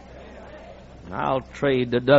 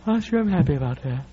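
mild, softer, bored Paul; lightly voiced Paul shrugging it off; unimpressed Paul; 4 dB quieter than usual. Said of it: "the Apostle Paul said".

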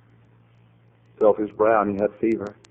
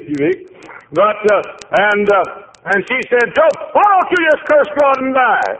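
high, loud preacher's cry; preaching at full force; 11 semitones higher, 7 dB louder than usual. Acted. Preacher crying out Job's losses to the congregation, full force. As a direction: shout the loss out over the pews.

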